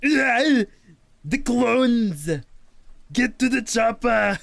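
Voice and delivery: Kermit doing Arnold. Retold or imitated. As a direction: squeak out Arnold lines like Kermit. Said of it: "Kermit is trying to sound like Arnold Schwarzenegger".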